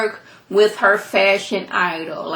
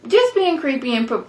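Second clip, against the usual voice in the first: ordinary delivery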